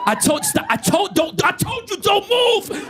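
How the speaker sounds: yard bully yelling. Retold or imitated. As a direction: bark the order at the shaking lab. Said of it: "He's like".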